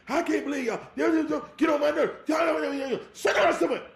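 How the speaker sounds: high, loud scolding mother; a mother losing it at her kids; furious mama yelling at the kids; mama fussing. Retold or imitated. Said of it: "your mama is wilding out".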